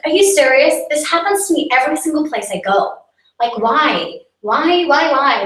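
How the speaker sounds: indignant, high and loud protest; super angry. Acted. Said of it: "I was getting super angry".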